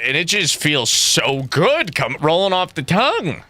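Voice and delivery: normal talking voice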